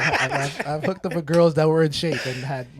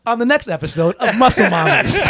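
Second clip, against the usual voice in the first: louder, higher TV teaser parody through laughter; mock announcer; 5 semitones higher, 6 dB louder than usual. Friend jokes the topic into a show, mock announcer. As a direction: plug it like a silly show promo.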